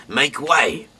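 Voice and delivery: loud herald calling out; guard shouting ahead of the chariot; crying out. Acted. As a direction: clear the road for the chariot, loud. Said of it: "cried out".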